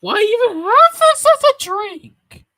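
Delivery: very high, loud yell; loud incredulous screech; outraged shout. Acted. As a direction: holler in high-pitched disbelief. Outburst at a disgusting drink.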